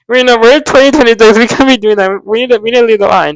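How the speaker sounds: high, loud, agitated rebuke; exclaimed scolding. Real, not acted. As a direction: call them out loudly. Sudden exclaimed aside, scolding.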